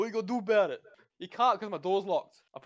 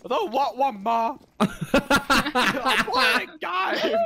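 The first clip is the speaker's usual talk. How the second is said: put-on whiny kid voice; 7 semitones higher, 8 dB louder, much slower than usual. Mocking a whiny kid to mum.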